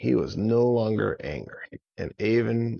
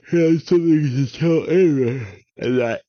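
agitated dragon; 7 semitones higher, 9 dB louder than usual. A narrator's reading. Narrator voicing the troubled dragon's message.

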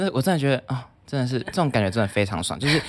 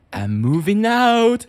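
smug announcement; 9 semitones higher, 5 dB louder, much slower than usual. Retold or imitated. Announcing departure to roommate.

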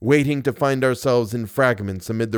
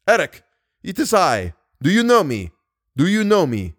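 higher, louder calling out; raised voice; 7 semitones higher, 3 dB louder than usual. A narrator's reading. Narrator as captive hailing Eric, raised voice. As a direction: raise your voice to be recognized. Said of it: "Then I raised my voice".

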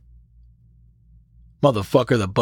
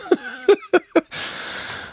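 breathy high laugh. Real, not acted. Laughs at own joke.

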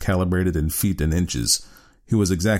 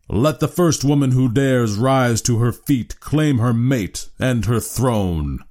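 grand, higher voice slowing to very slow at the end; regal challenge; 6 semitones higher, 3 dB louder, slower than usual. A narrator's reading. Harrison challenging the women to rise, regal. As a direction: summon the bravest woman. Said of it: "he said, looking down".

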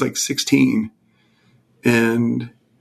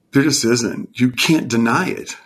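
ordinary talk, nothing marked

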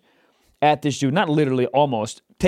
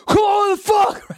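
high-pitched angry road-rage yell; imitated yelling; 14 semitones higher, 3 dB louder than usual. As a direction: mimic a driver yelling out the window. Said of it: "So when he was yelling".

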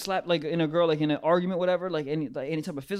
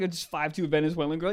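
ordinary talk, nothing marked

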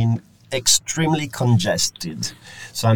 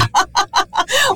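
high-pitched burst of laughter; amused high laugh; real laugh; 3 dB louder than usual. Real, not acted. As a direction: crack up at the guest's joke. Amused by guest's city center remark.

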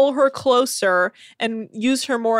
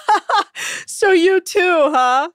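mock laughing; 5 semitones higher, much slower than usual. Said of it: "And Laura's like".

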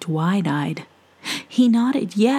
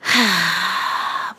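sigh. Acted. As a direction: sigh before confiding. Witch sighs.

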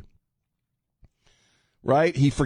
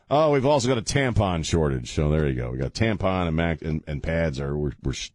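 ordinary delivery

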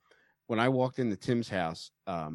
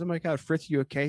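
lightly imitated concern, pitched higher; a touch of alarmed mom; worried wife; 6 semitones higher than usual. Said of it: "And my wife goes".

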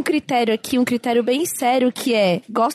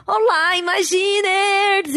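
excited intro; 8 semitones higher, much slower than usual. Acted. Host opening the show.

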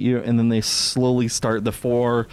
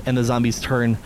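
normal talking voice

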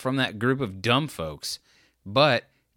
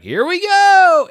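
much higher and louder, slow and stretched; gleeful hype; 11 dB louder, slower than usual. Retold or imitated. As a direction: announce the thrill ride like a fun uncle. Fun uncle starts swerving the van.

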